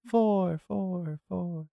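fading vocal echo on the last word; echo effect. Shown as a demonstration. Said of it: "Do you like the echo effect I put on my voice?".

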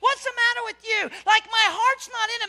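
high, loud fervent preaching; full-force preaching. Real, not acted. Preacher urging the congregation to preach to themselves, fervent. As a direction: preach it to yourself with fire.

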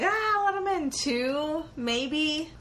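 high, slow, unsure plea; hesitant pushover. Retold or imitated. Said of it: "I might be like".

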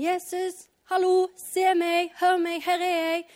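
loud, high-pitched acted calling; shameless shouting. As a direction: holler for attention over a crowd. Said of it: "uforskammet roper han bare sånn".

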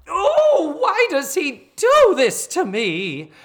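hammed-up wail, very high and loud; Missus Garrett. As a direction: draw out a melodramatic, woe-is-me lament. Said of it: "I won't do it in that voice".

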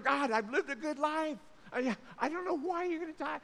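high-pitched mock plea to God; acted crying out. Dying king cries out to God, high mock pleading. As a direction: plead upward in a hammed-up protest. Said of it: "he's crying out".

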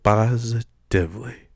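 slow dramatic pauses; dramatic announcer. Acted. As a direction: draw it out for effect.

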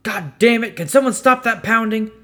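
loud, high-pitched irritated yell; exasperated meathead yelling out; angry shout. A narrator's reading. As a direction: shout it across the station, fed up. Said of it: "one of the meatheads shout".

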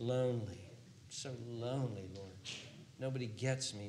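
ordinary talk

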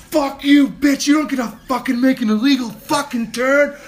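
high, loud screaming rant at the other driver; furious shout. Retold or imitated. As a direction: let it all out, yelling at her. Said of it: "And I lost my shit".

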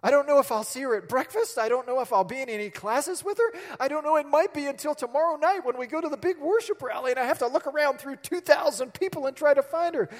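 mock panic of a lovesick student; anxious rambling. Young self's anxious rambling retold.

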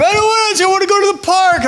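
put-on high-pitched little girl shouting no; whiny child protest. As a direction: shout it like a stubborn small daughter. Mother voices her daughter's protest.

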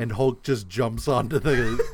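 ordinary delivery

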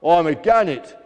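loud, defiant self-announcement; shouted boast. A narrator's reading. Gannet answering the protesting gull, shouted. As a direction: declare it with brash volume. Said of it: "Grabby shouted".

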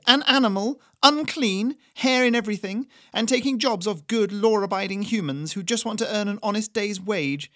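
put-on high, loud, scornful old woman; bigoted rant. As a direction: spit out each insult. Doris rants at rabbit chef.

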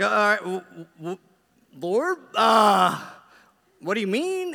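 stammering, loud, high-pitched nervous prayer; flustered mock alarm. Pastor nervously asking the Lord after a shocking confession, flustered. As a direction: stumble through it in comic dread.

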